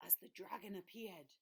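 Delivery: hushed awed whisper. A narrator's reading. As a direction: breathe it out in awe. Unvoiced gasping crowd.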